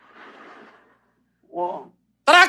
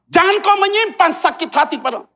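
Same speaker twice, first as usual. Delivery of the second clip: firm command; 8 semitones higher, 5 dB louder than usual. Retold or imitated. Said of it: "Tegas bukan kata kasar".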